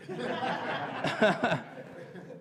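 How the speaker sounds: soft airy laugh after fumbling; preacher laughing at his own mistake; breathy real laugh. Real, not acted. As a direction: let out a quiet laugh at yourself.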